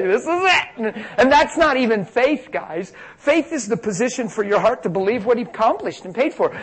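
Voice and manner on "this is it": very high mock-desperate cry; mock desperation. Moms showing how they think, mock desperation. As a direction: overwrought parent, hammed up high. Repeats the overwrought moms' cry.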